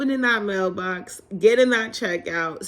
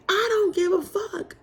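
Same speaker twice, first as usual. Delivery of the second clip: high, tearful wail; a real sob in the high cry; crying outburst; 12 semitones higher than usual. Retold or imitated. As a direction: wail it through tears, breaking. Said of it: "And I'm like".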